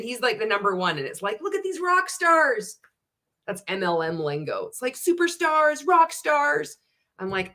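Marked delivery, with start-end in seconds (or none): 1.4-2.8 high-pitched mocking gush; mock hype. Mocking hype of MLM lingo. 4.9-6.8 high, gushing mock promoter; mock hype. Mocking MLM lingo.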